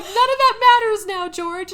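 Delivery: high, loud mock urgency; Frank. Frank cutting off George's rant, loud high mock exasperation. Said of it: "Frank is like".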